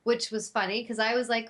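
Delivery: plain reading voice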